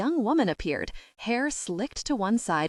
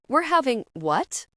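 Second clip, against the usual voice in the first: higher, louder startled question; shocked exclamation; 6 semitones higher, 3 dB louder than usual. A narrator's reading. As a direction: blurt it out in disbelief. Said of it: "Patrick exclaimed".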